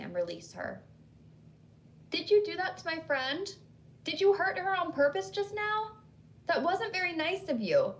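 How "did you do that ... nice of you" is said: yelling out. A narrator's reading. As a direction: call out to the unseen in anger. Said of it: "Clarissa yelled out".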